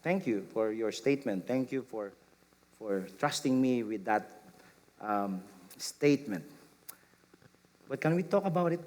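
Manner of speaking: calm and kind, lightly done; gentle reply. Retold or imitated. Speaker gently answering a coworker, understated. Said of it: "I responded with".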